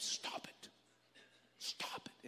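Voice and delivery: hushed stern whisper; mock church whisper; whispered scold. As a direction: hiss it at a fidgeting child. Hushing a child in church.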